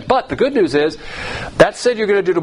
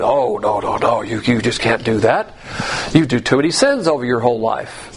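low-pitched mock legalist objection; offended legalist; 5 semitones lower than usual. Preacher mimics legalists objecting to easy salvation, low and offended. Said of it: "a lot of legalists get offended".

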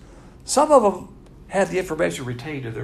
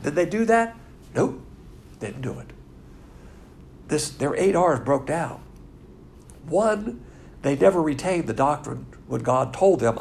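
ordinary talk, nothing marked

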